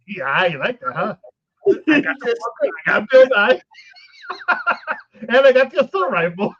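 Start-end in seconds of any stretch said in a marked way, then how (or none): 1.9-6.5 loud, high triumphant brag; laughing brag over the haul; gleeful boasting. Real, not acted. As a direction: crow about the loot. Boasting about loot.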